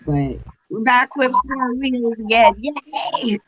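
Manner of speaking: high-pitched happy announcement rising into a yay; celebrate, pitched well above normal talk; excited cheer. Real, not acted. Investigator announces they have four wheels again, excited cheer.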